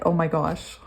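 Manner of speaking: ordinary delivery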